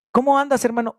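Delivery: high, bright mock greeting; hammed-up cheerful hello; cheery greeting. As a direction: greet a brother warmly and high. Preacher acts greeting between believers.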